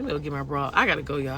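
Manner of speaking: ordinary talk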